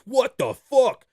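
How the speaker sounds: high, slow, loud mock shock; stretched-out, high-pitched disbelief; imitated astonishment. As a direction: imitate a stunned passer-by. Imitating onlookers stunned by huge dog.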